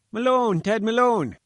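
high, loud plaintive call from afar; a fat little man bleating for his friend. A narrator's reading. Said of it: "a plaintive bleat behind us".

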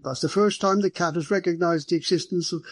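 high-pitched, marvelling superintendent voice; superintendent, surprised. Acted. Superintendent remarking on the cat's unusual friendliness, surprised.

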